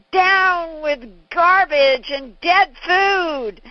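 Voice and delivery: high, loud, slow fiery preaching; preacher's roar. Retold or imitated. Father pounding the table at the audience, roaring. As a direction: thunder it like a health crusader. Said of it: "He said".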